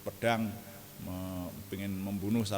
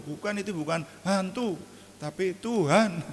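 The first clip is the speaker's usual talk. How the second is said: high, loud, slowing on tapi Tuhan; insistent correction; 9 semitones higher, 5 dB louder than usual. Pretend. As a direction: insist it is the Lord, not a ghost. Jesus answers the frightened disciples.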